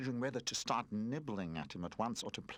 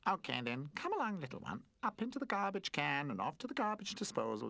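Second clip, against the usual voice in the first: raised, teasing sing-song offer; mocking garbage can voice; 6 semitones higher than usual. A narrator's reading. Garbage can teasing the frightened toy, mock laughing. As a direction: lure the little one in with a mocking grin. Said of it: "laughed Mumph".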